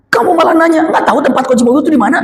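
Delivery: put-on high, fast exasperated snap at the child; snappy irritated reply. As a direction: act annoyed at the question. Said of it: "Kenapa harus ngegas gitu". (membentak dengan kesal)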